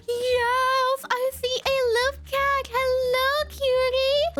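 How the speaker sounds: high, bright delight; excited. Real, not acted. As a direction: squeal with joy at a cute cat. Finds the loaf cat.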